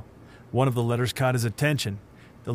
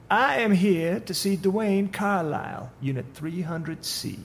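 higher, slow and silky; creepy stranger; 9 semitones higher, slower than usual. Acted. The man in the dark suit speaks to the supervisor in a slow, higher, creepy voice. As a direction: draw it out like a sinister visitor.

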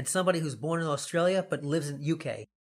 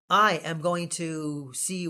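normal talking voice

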